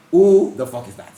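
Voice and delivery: laughing disbelief; amused who-is-that outburst. Retold or imitated. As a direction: blurt it out through a laugh. Laughing retold reaction.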